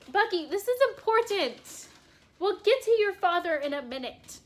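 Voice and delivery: mock-stern owner scolding her pet; telling off the dog. Real, not acted. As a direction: call the dog to order. Scolding her puppy off camera.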